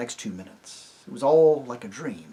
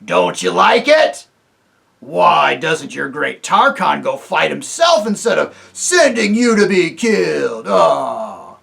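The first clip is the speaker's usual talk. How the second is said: louder mocking taunt; jeering dwarfs; 5 semitones higher, 11 dB louder, slower than usual. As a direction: rub it in with scorn.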